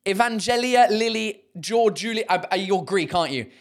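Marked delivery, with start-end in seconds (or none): none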